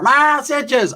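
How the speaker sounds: high mock yell out the door. Mom yelling from the door at men walking past. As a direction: holler it at passers-by. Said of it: "she'd yell out the door".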